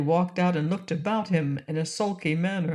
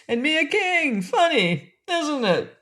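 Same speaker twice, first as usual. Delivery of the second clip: laughing; 10 semitones higher than usual. Acted. Jolly king's catchphrase.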